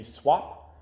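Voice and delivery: ordinary delivery, nothing marked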